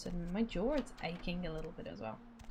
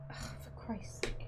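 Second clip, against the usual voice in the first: exasperated whisper; 2 semitones higher, 7 dB quieter than usual. Real, not acted. Annoyed at the game controls.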